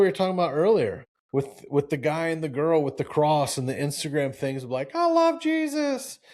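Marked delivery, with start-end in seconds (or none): 5.0-6.2 high, slow, sugary mock voice; mocking the couple's pious post in a high, drawn-out voice.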